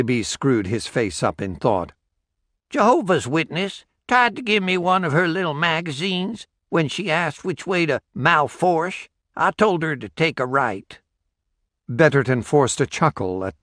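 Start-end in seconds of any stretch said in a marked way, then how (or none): 2.7-11.0 put-on higher, sly old rural man voice; old country porch-sitter. Old Billy B sending a stranger the wrong way, sly and higher. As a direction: play the crafty old local, pitched up.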